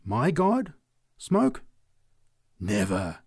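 scandalised, questioning then firm; indignant cry. A narrator's reading. Preacher stung by sacrilege, indignant cry. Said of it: "drew herself indignantly erect".

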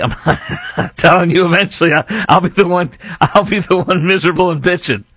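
high, laughing self-mockery; chuckling at his own future grumpiness. Real, not acted. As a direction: giggle through the confession about yourself.

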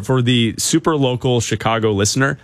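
ordinary talk, nothing marked

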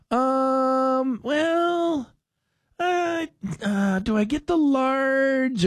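high, hesitant, slow dithering voice; mock a customer who can't decide; indecisive customer.

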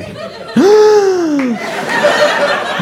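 big, drawn-out high gasp; hammed-up gasp of sudden alarm; panicked gasp. Now I'm panicked.

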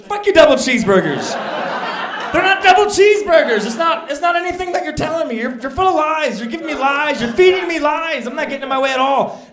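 imagined yelling at the corporate phone rep; furious rant. Pretend. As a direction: blow up at the rep.

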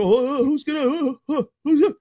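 high, whiny mock panic rising to a wail; mocking Cubs fans. Mocking worried Cubs fans to the hosts, a high whiny wail. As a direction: whimper like a fretting Cubs fan. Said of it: "I know the Cubs fans go".

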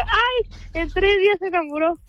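"Ay" agudo y arrastrado, luego rápido y burlón; burla incrédula. Retold or imitated. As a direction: se ríe de él sin creerle. Mocks the ex's declaration of love. (burla aguda y rápida del exnovio)

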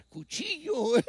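high-pitched, through laughter; amused squeal breaking into laughter; laughing punchline. Real, not acted. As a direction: crack up while delivering the word. Joking punchline amid his own laughter.

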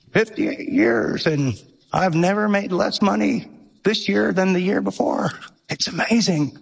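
a hint of an elderly architect reminiscing; old man imitation. Pastor imitating an old generous giver, light old man voice. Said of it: "he was really old".

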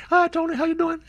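high imitation of a sweet lady; imitating her voice. Host imitating the extension lady's greeting up high. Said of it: "That's how she talked".

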